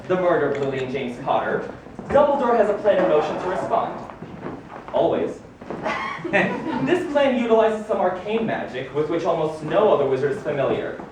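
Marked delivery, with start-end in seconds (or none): 5.6-6.8 breathy chuckle. Acted. Reading his own biography, a breathy amused chuckle. Reacts to reading about himself.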